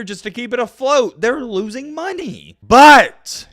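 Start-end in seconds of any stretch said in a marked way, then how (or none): 2.6-3.5 loud, high, punched BUT; one loud booming But; big emphatic but. Real, not acted. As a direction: slam down the word But like a gavel. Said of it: "and I have a big but".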